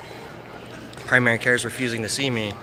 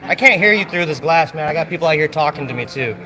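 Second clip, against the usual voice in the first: loud, higher, fed-up call; frustrated shouting; 4 semitones higher, 6 dB louder than usual. Real, not acted. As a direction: raise it to carry through glass. Shouting through glass at desk.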